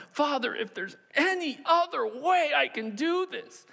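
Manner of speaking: high, drawn-out tearful plea; slow, high-pitched desperate appeal; anguished prayer. Acted. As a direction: beg the Father slowly, straining upward. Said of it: "Jesus wept in the garden".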